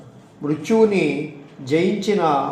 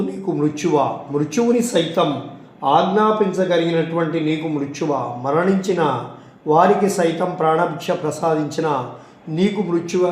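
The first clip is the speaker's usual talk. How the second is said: ordinary delivery, nothing marked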